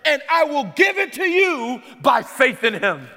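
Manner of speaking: loud preached voice of God; voicing God. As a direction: proclaim it as God's promise. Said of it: "But God says".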